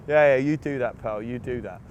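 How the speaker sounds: loud, mocking brush-off; sarcastic dismissal. Player dismissing a supposed prank caller sarcastically. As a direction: humour the prankster, not believing a word. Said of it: "thought it was a wind-up".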